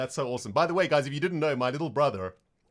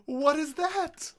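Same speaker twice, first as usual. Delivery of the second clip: overwhelmed by cuteness; 10 semitones higher, slower than usual. Real, not acted. Sees creeper face.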